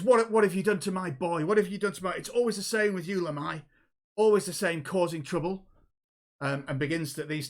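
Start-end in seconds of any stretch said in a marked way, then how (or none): 0.0-5.7 raised, higher-pitched scolding; acted exasperation, loud at the start of each reproach; outraged mother. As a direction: confront an old rival over your child. Kobold mother confronts the gnome stallholder.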